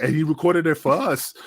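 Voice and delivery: normal talking voice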